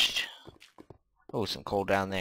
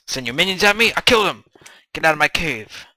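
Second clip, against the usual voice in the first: loud, higher-pitched taunt at attackers; mock battle shout; 8 semitones higher, 11 dB louder than usual. Gamer to attacking slimes, mock defiant shout. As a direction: yell defiantly at game monsters.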